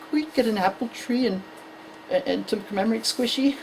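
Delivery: soft, halting little voice; mournful child. Acted. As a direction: quietly break the sad news. Son's sad request, acted.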